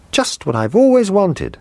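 put-on high-pitched boyish delight; thrilled child with a longed-for present; delighted cry. A narrator's reading. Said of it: "he cried".

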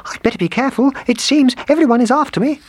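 very high little character voice, quickening; gingerbread man voice. A narrator's reading. Gingerbread man chased by everyone, high wary squeak. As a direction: squeak it out as a tiny figure.

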